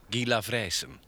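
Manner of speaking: normal talking voice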